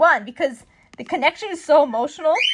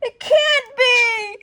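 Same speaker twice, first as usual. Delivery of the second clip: wailing cry; 12 semitones higher, 5 dB louder, much slower than usual. Acted. Acting shocked disbelief.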